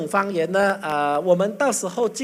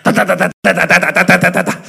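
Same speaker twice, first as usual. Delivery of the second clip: loud rapid 哒哒哒 like gunfire; loud rattling machine-gun babble; machine gun tongues; 2 semitones lower, 8 dB louder, faster than usual. Shown as a demonstration. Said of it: "要么叫机关枪".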